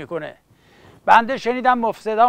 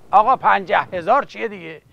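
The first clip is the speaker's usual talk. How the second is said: ordinary talk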